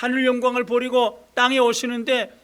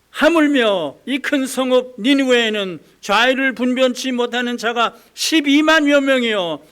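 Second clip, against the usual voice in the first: loud declaration; 7 dB louder than usual. Acted. God's climactic rebuke.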